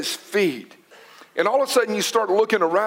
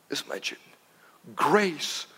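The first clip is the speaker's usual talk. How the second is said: dropped to a whisper; hushed sermon aside; 7 semitones lower, 6 dB quieter than usual. Real, not acted. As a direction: let it fade to a whisper.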